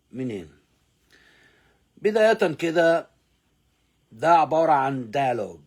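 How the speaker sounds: ordinary talk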